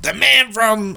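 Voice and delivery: high-pitched call through a wall; imitated yelling. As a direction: mimic a wife calling out to her husband. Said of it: "I can hear her yelling".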